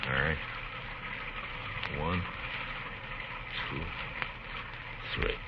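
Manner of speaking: low, soft, tense planning under fire; hushed. Acted. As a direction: keep your voice down, he's listening. Whispered planning pinned down.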